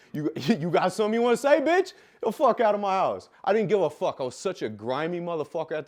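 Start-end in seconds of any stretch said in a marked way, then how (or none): 0.1-3.3 louder, higher cocky confrontation; acted aggressive party bravado; belligerent challenge. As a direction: throw them out, picking a fight. Acting himself belligerent while partying.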